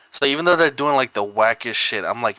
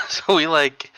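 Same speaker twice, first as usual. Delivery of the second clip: plain reading voice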